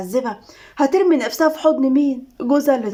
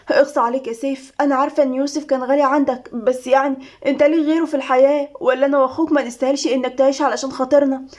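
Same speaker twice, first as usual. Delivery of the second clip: usual speaking voice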